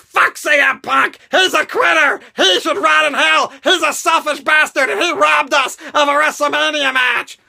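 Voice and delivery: angry fan yelling. Retold or imitated. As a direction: rail against Punk like a heated hater. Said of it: "other people are like".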